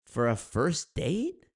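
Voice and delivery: slow, disbelieving question; incredulous. Real, not acted. Vlogger reacting to a pricey date idea, slow disbelief. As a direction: stretch it out in disbelief.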